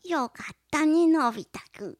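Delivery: 大山のぶ代のドラえもん. Retold or imitated. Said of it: "もう一回、もう一回". (ドラえもんの声まね)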